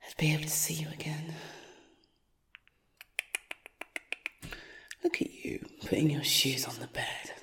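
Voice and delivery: hushed menacing whisper; creepy whisper. Acted. Stalker whispers to victim.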